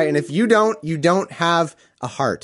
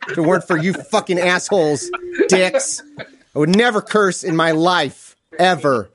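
mock outrage; 5 semitones higher than usual. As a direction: pretend to blow up at them while cracking up. Mock rant at radio hosts.

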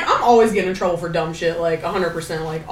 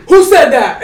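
stern demand; 5 semitones higher, 7 dB louder, slower than usual. Retold or imitated. Drill instructor catching her.

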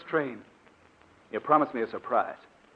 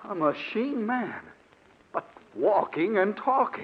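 higher and slow, disbelieving; amazed wonder; 7 semitones higher than usual. Acted. Doctor staring at the walking robot, slow amazed wonder. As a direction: marvel at it slowly.

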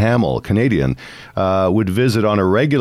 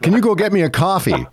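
higher, laughing through the quoted request; mock the request while cracking up; laughing quote; 9 semitones higher than usual. Retelling how the husband asked for coffee, laughing.

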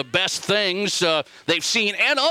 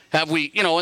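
usual speaking voice

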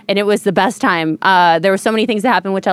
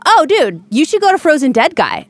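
higher-pitched, lightly excited imitation of the kid; enthusiastic retold recommendation; 6 semitones higher than usual. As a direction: pass on a hot tip like an eager stranger. Retelling stranger's enthusiastic festival tip.

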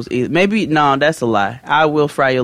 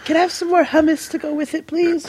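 high prim customer voice, very polite; snooty poodle voice; 13 semitones higher than usual. Pretend. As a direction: ask sweetly in a high put-on voice. Mock customer asks for more.

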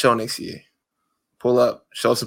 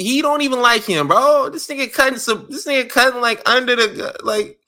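worked-up rant; 9 semitones higher than usual. Real, not acted. Mocking outrage over feud.

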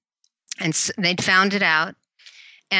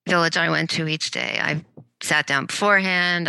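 ordinary talk, nothing marked

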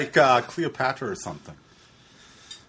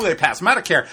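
ordinary talk, nothing marked

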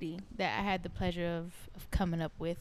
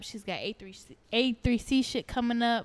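ordinary talk, nothing marked

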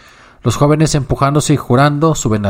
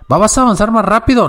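much higher, indignant demand; indignant yell; 10 semitones higher than usual. Acted. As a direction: scold the driver to go faster. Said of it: "grita el jorobado con indignación".

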